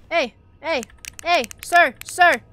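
loud hey, sir across the lot; calling out. Real, not acted. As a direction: call to a man to get his attention. Calling out to a stranger.

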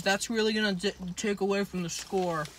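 speaking with food in mouth; words between bites; talking while chewing. Real, not acted. Seen 0: Left speaker bit food just before, lips work around food while talking.

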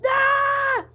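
long, high-pitched "ah" scream. Real, not acted. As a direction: cry out high and drawn-out. Jacket is coming off.

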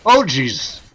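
loud, startled cry; alarmed shout. Real, not acted. Battle going badly.

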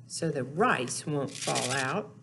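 ordinary talk

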